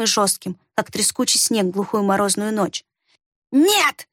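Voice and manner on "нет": sharp high cry of refusal; shout. A narrator's reading. Варх cries out, terrified of the witch, high shout. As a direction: cry it out in panic. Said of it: "выкрикнул он".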